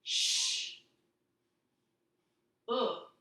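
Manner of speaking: teacher blends shook slowly, sound by sound. Shown as a demonstration. Teacher sounding out shook for young readers. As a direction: stretch the sounds of shook apart, sh then ook. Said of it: "your fourth word is shook".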